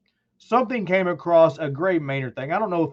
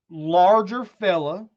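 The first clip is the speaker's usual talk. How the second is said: normal talking voice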